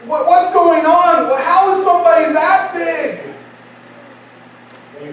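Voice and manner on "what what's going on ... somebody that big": high, slowed, bewildered exclamation; astonished onlooker; stunned disbelief. Acted. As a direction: gape aloud at something huge. Acted onlooker stunned by giant.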